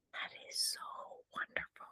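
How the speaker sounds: breathy hushed whisper of delight. Real, not acted. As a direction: drop to a soft secret whisper. Whispered aside while teaching.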